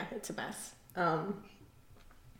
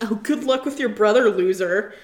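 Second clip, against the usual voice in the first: mock-bitchy retelling, a bit louder; 6 dB louder than usual. Retelling a rival's supposed insult to her teammate, mock-bitchy. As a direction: imitate a snide send-off with a jab at the end. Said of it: "like, a bitch and saying".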